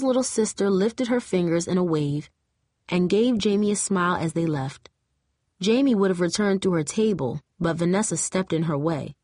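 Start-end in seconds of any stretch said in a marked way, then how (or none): none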